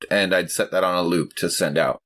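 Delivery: ordinary delivery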